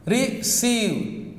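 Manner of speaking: Receive broken in two, re, then ceive; split into syllables. Shown as a demonstration. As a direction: count out Receive as two separate beats. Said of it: "How many syllables?".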